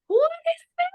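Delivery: high, astonished what-is-this; squeaky baffled double take; startled question. Retold or imitated. Retelling her own shock, a startled high question.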